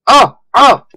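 two loud, high yelps; startled yelps. Real, not acted. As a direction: yelp sharply in surprise, twice. Startled in game.

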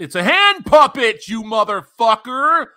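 fed-up shout: it's a hand puppet; exasperated yell. Real, not acted. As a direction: yell it like you can't believe this. Yelling at Wheaton's post.

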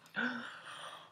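sharp high gasp of awe; stunned gasp. Real, not acted. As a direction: gasp in delight. Reaction to music video.

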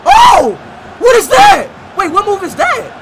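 high, loud shocked outburst; surprised. Real, not acted. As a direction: gasp at the skill. Reacting to surprise skill move.

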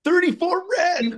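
thirty-four red, called out high and bright; sudden excited announcement of the win. Real, not acted. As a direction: cheer the winning number as it lands. Winning roulette number called.

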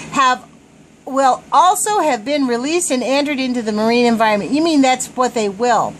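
ordinary delivery

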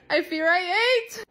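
high, loud, drawn-out brag; high-pitched celebratory squeal; gleeful boasting. Real, not acted. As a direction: sing out the triumph, stretching the words. Brags about her new photo.

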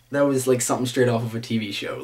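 normal talking voice

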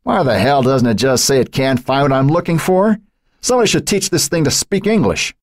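high-pitched exasperated rant. A narrator's reading. Jerry ranting at the computer's search message, exasperated. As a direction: complain at the useless machine, pitch climbing. Frustrated man rants at his computer.

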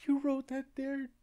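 squeaky high retold quote; high, slow, mocking voice.

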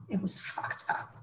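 slow breathy whispered aside. Real, not acted. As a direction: whisper it, unsettled. Disturbed aside.

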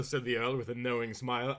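ordinary delivery, nothing marked

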